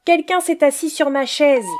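mama bear, indignant; middle bear's voice. A narrator's reading. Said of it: "dit l'ours moyen de sa voix moyenne".

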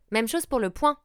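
ordinary talk

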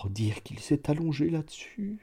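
murmure doux, plus aigu; murmuré. A narrator's reading. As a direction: souffler pour soi, ému. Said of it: "murmurait l'excellent homme". (le bourgeois murmure devant la planche)

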